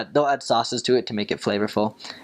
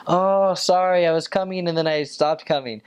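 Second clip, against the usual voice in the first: higher, slowed, half-hearted sorry; mock casual apology; 7 semitones higher than usual. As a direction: shrug off being late with a limp sorry. Mimicking the investigator's lame apology.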